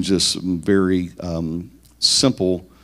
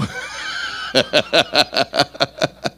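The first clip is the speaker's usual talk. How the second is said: breathy high giggle as he switches persona; a playful, airy laugh at himself; a light, self-amused laugh; 8 semitones higher than usual. Pretend. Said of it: "my I personality".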